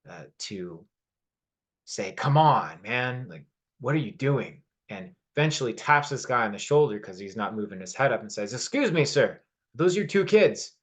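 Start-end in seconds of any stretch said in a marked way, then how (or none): 2.1-4.6 mock irritation. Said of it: "gets very frustrated and irritated and angry". 8.5-10.8 put-on higher, louder, an indignant old man confronting a stranger; a retold old man's angry challenge; old man on the bus scolding a father, indignant and raised. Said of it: "this tone of very much anger and frustration in his voice".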